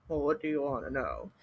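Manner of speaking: put-on low, gruff old tree voice. Channeling the redwoods' reply to the group, gruff. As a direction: grumble it low, gruff and curt. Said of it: "it was very gruff".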